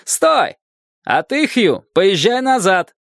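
high, drawn-out calls; slow storybook shouting, pitched well up; shouting across water. A narrator's reading. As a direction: call out orders from the island. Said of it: "крикнул человек на острове".

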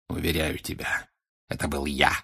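low, soft, unhurried; cold assurance. Acted. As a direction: confirm it with quiet menace. Villain confirms calmly. (тихо, низко, зловеще)

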